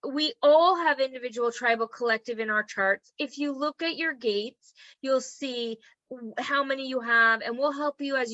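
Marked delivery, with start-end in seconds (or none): none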